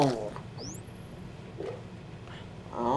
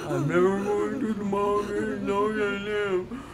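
mock wailing complaint; 6 semitones higher than usual. Gamer fed up with the mall, mock high wailing.

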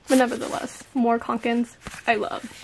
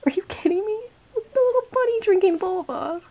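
delighted squeal; 8 semitones higher than usual. Real, not acted. Delighted at bunny sticker.

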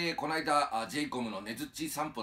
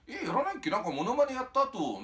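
put-on much higher and louder puzzled old man; surprised old man; 14 semitones higher, 5 dB louder than usual. 芸人の否定にびっくりするおじさん、ずっと高く大きく. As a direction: squeak up in honest disbelief. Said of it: "って言われてね".

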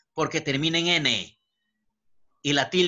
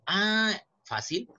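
drawn-out, higher "ah" of realization; easy realization; 6 semitones higher, slower than usual. Acted. Teacher models a pupil consulting the rule, relieved realization. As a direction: act out finding it simple.

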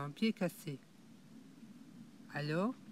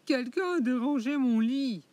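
higher, louder annoyed mama bear; angry mama bear; 6 semitones higher, 6 dB louder than usual. Acted. La maman ours de plus en plus fâchée, s'énerve. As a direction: let her temper burst out. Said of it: "s'énerve".

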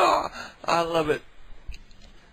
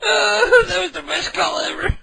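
high, cracking voice through laughter; laugh-cry with delight; squealing laughter; 13 semitones higher, 3 dB louder than usual. Real, not acted. Laughing after threat.

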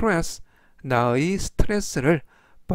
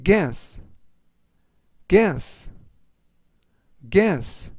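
slow word reading; 3 semitones higher, much slower than usual. Shown as a demonstration. Teacher reviewing today's words for learners, slowly. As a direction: guess read slowly as a single word. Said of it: "제가 천천히 세 번씩 읽어드리고요".

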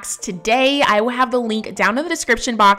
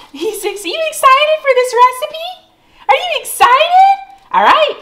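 excited dog talk; 7 dB louder than usual. Real, not acted. Owner hypes her dogs before dinner, high squeaky baby talk. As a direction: gush at the dogs like they're toddlers.

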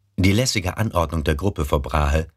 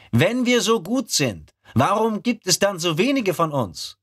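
higher, probing question; skeptical recruit. Acted. Rekrut fragt skeptisch. (skeptischer Rekrut)

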